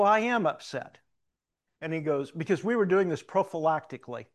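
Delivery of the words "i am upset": raised, louder retold insistence; emphatic retold admission; upset retort. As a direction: push back, stressing that you are upset. Retelling his upset retort to doctor.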